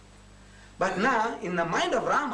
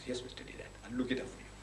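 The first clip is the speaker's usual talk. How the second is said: a near-whispered, much softer promise; hushed meek reply; 6 semitones lower, 12 dB quieter, faster than usual. Retold or imitated. Mr Naidu politely promising to look it up, hushed. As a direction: shrink the voice down to a hush.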